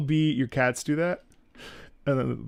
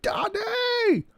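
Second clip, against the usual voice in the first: imitating the dog. Owner voicing his dog bursting through the door. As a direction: squeal it like an excited little girl. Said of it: "we have a voice for it".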